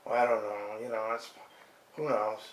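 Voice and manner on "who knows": ordinary delivery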